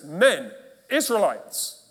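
"Men, Israelites" spelled out slowly and loud; slow emphatic reading. Shown as a demonstration. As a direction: lay each word down deliberately. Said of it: "It literally says".

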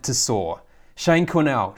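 normal talking voice

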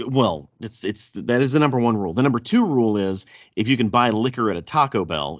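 normal talking voice

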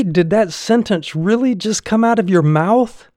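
can you believe this; indignant disbelief. Retold or imitated. Patient scolding the young doctor, indignant disbelief.

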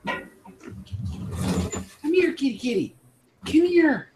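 high cooing call to a cat; calling a kitty. Real, not acted. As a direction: coax the cat in a sing-song.

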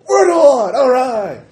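high shouting whoop; excited cheer. Acted. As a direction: cheer at the top of your voice.

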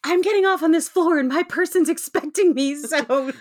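put-on old-lady voice, insisting someone is waiting; imitating the old lady. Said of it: "And I love her, like".

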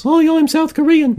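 very high, slow, silly put-on voice; mock South Korean. Sample from a joke accent ad, mock South Korean in high voice. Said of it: "South Koreans".

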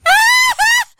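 high burst of laughter; shrieking laugh. Real, not acted. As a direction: crack up loudly. Big laugh.

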